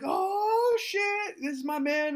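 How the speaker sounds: high, loud hyped-up mimicry; excited students. Class cheers teacher on his return, high mock excitement. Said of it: "I walk back in the class".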